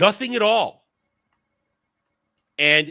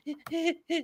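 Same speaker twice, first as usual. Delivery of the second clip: high silly giggle; mock girly giggle; 12 semitones higher, 7 dB quieter than usual. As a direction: giggle like a smitten girl. Mock robot girl giggle.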